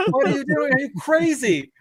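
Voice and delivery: high-pitched mock panic; hysterical friend. Friend peering from the car window, high mock hysterics. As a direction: squeak it out like a terrified passenger. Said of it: "So she's hysterical".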